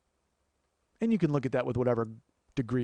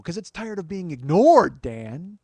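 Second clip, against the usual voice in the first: loud, slow, rising threat; hammed-up menace, then drop on "Dan"; Glenn Close menace; 5 semitones higher, 10 dB louder, slower than usual. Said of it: "Glenn Close".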